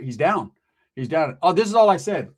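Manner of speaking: normal talking voice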